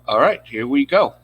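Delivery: ordinary talk, nothing marked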